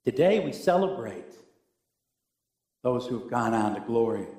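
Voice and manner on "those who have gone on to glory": ordinary talk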